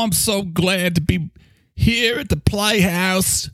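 put-on high, slow mangled Cockney-Australian accent; a cheery, terrible Cockney-Australian voice; bad Cockney accent. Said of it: "Is that Australian? Or Cockney?".

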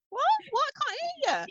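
very high, softened mock voice; teasing high-pitched put-on voice; mock not hearing. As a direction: pretend you can't hear through the glass. Acts out mocking the trapped person.

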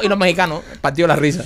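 ordinary talk

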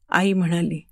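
plain reading voice

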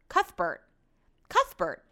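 Cuthbert repeated with lisping th; lispy. Shown as a demonstration. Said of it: "I sound like I have a lisp".

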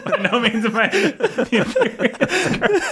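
high, slow, breaking into laughter; barely getting it out through laughs; laughing. Real, not acted.

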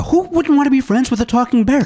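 high, delighted outburst; suddenly thrilled by the idea; excited. Real, not acted. Host gushing to Matt about talking bears, excited.

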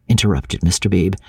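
ordinary delivery, nothing marked

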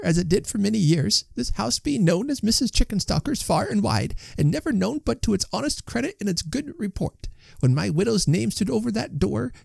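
very high, impassioned woman's voice without loudness; energetic indignant wife. A narrator's reading. Wife refusing to turn the lodgers out, high impassioned protest. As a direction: plead and scold in a high, soft voice. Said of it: "said Missus Tugby with great energy".

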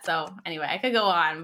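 ordinary talk

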